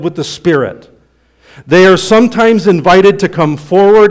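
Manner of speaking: high and loud pulpit preaching; fiery preaching. Acted. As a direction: preach it out with conviction. Preaching at full force.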